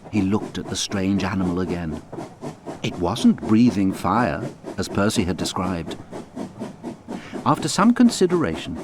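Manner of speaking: usual speaking voice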